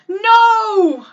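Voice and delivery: high loud desperate no; anguished cry. Acted. Eric cries out as Ariel is dragged away, loud desperate cry. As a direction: cry out in despair. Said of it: "cried Eric".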